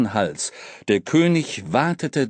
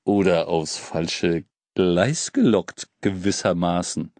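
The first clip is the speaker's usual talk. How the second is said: sly hobo; 6 semitones lower than usual. Acted. King adds a sly afterthought.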